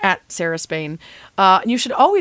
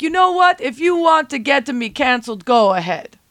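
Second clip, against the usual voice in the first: mock exasperated outburst; 6 semitones higher, 4 dB louder than usual. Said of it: "And I was like".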